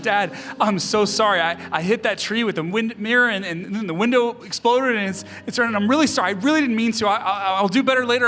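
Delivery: retold panicky apology, high and loud; imitating a scared teenager pleading, high-pitched; tearful, afraid apology. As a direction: blurt out a frantic sorry to dad, acted. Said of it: "I'm almost in tears".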